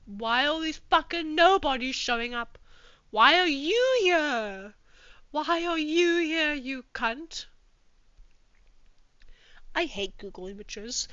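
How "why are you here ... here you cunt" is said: slow, loud, high demand; angry demand. Acted. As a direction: drag out an outraged question. Yelling at picture.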